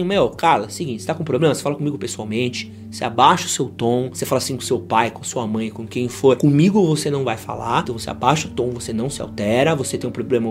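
put-on firm boyfriend. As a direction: calmly set limits, no shouting. Voicing a firm talk to a partner.

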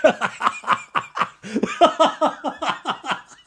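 long high cackle of real laughter. Real, not acted. Host cracking up at own joke, real high laugh. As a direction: burst out laughing.

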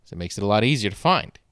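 ordinary talk

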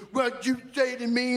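high mock threat; mock tough guy. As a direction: lightly mimic the angry moviegoer. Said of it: "He goes".

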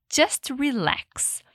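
just relax, drawn out slowly; slow soothing teacher model; slow and calm. Shown as a demonstration. Teacher soothing a yoga class, slow and calm. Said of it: "na maior paciência, recomenda".

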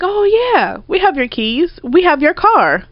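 mocking casual. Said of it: "You were like".

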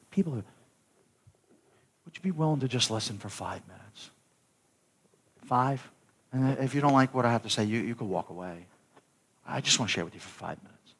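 soft, unhurried invitation; gentle appeal. Acted. Gently models asking someone to listen.

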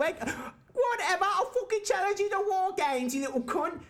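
high mock British accent, laughing; funny British voice. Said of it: "when you say it in a funny voice".

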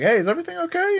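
high-pitched, overly casual question; awkwardly casual check-in. Retold or imitated. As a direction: voice a suspiciously innocent check-in. Voicing nervous small talk.

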